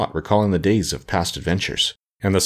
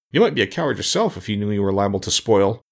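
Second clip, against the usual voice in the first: plain reading voice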